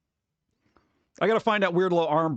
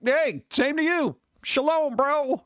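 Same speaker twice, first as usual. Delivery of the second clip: cheery greeting; 8 semitones higher, slower than usual. Retold or imitated. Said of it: "I'm just like".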